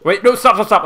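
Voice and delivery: panicking gamer. Real, not acted. Pleading during game chaos.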